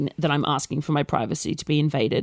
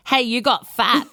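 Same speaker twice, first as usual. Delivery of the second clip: higher, slow and taunting; mocking rude commenter; 6 semitones higher than usual. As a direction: imitate a rude troll, dragging it out. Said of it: "comments from people being like".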